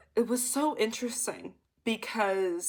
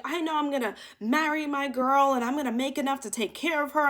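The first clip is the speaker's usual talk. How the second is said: higher-pitched mock ranting; imitated freak-out; 5 semitones higher than usual. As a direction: run on like a man losing his composure. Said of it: "like, he freaks out".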